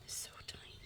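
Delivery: awed whisper, no voice. Real, not acted. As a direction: whisper in wonder at something tiny. Marvelling at the bird.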